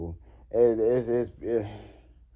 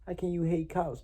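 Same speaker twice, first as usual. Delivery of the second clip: high, mocking imitation of people; a lighter pitched-up parody; mocking quote; 9 semitones higher, 4 dB quieter than usual. Retelling what people ask him, high mock voice. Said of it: "people be like".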